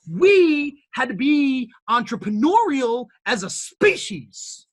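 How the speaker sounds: high, loud motivational sermon; rally the room like a preacher; preaching build. Real, not acted. Speaker rouses listeners about human entrepreneurship, building preaching.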